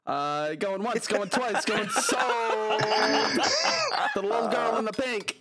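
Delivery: high, excited auctioneer patter. Retold or imitated. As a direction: rattle off bids like an auctioneer.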